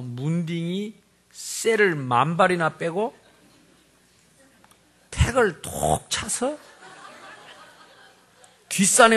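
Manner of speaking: drawn-out mock scolding, rising at the end; imitated mother's cursing. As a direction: act out a mother's colourful cursing. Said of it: "막 욕합니다".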